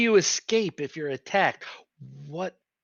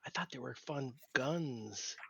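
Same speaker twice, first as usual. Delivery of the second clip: normal talking voice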